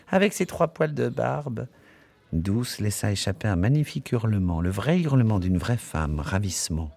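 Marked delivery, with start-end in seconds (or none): 0.1-1.7 high teasing mewl; mewing. Acted. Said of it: "miaulait mademoiselle Kennedy".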